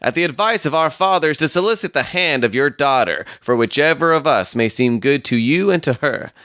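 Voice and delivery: high, formal suitor's voice; self-important young suitor. Acted. Stangerson soliciting the daughter's hand from Ferrier, high and pompous. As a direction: make the claim with pompous formality.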